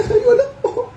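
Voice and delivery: high laughing "ay"; squeaky laughing aside; laughing exclaim. Acted. Laughing reaction in story.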